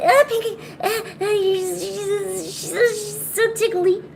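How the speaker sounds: high, drawn-out ticklish squeal; real ticklish yelps; squealing from tickling. Real, not acted. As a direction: squirm and squeal as the pet tickles you. Being tickled by the pet.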